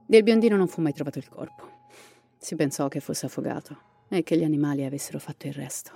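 dramatic storyteller reading a ghost legend; story narration. A narrator's reading. Narrator telling listeners the Llorona legend, dramatic storytelling.

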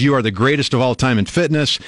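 normal talking voice

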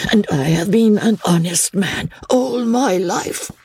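put-on high-pitched old peddler voice; humble old peddler. Old peddler assuring Chauvelin of his honesty, high humble voice. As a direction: plead honesty in a high character voice.

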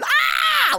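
high, loud squeal of joy; imitated delighted scream; mock excited scream. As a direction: shriek like spotting a friend. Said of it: "like, screaming that there she is".

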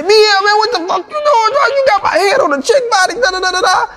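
Thug yelling in disbelief; outraged Thug. Retold or imitated. Thug yelling at the director over his head on a body. Thug upset about the video.

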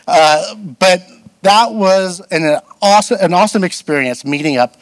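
ordinary delivery

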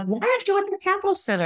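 high, stunned demand to her husband; indignant disbelief. Retold or imitated. Wife demanding why husband skipped the competition, high and indignant. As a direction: ask it like you can't believe he's home. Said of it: "And I'm like".

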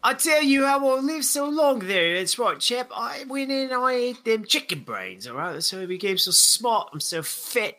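high-pitched mock Australian old man sliding into British; an Aussie codger voice that drifts into a British accent; Australian then British accent. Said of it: "your Australian accent quickly went British".

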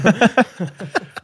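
high bright laugh bursting out; real amused laugh; burst of laughter. Real, not acted. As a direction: laugh it off in disbelief. Laughing at the price.

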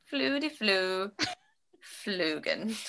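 mock Swedish chef gibberish; slow hammed-up Swedish nonsense; fake Swedish accent. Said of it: "a fake Swedish accent".

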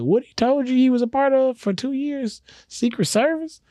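high-pitched disbelief; incredulous question. Retold or imitated. Friend reacts to husband's absurd lie, incredulous. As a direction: shoot the pitch way up, astonished.

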